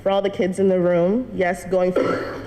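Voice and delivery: usual speaking voice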